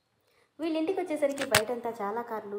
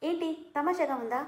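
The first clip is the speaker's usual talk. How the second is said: higher, sharp angry retort; 7 semitones higher, 4 dB louder than usual. Acted. Mother scolding husband for joking, angrily. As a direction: snap back, stung by the joke. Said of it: "అని కోపంగా అంది సిరి".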